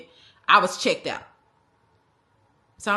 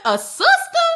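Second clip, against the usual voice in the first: very high, loud squeal of disbelief; are you serious, shrieked high; a sister, shrieked very high and louder; outraged disbelief; 6 dB louder, slower than usual. Real, not acted.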